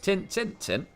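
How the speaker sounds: a light Yorkshire demo for the viewers; Yorkshire accent. Shown as a demonstration. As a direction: say it like a proud Yorkshireman. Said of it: "one famous phrase in a Yorkshire accent".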